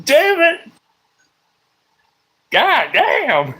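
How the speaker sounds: high, squeaky frustrated curses; exasperated high-pitched outburst; frustrated yelps. Real, not acted. Frustrated at flubbing the line.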